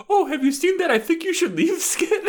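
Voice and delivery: high, laughing, gushing fan voice; mocking impression. Imitating a coworker mocking Shay, high and laughing. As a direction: gush about the show with a laugh. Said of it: "did an impression of her by going".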